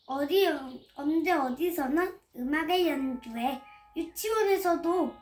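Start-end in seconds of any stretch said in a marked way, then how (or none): none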